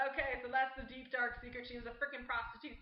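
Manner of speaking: normal talking voice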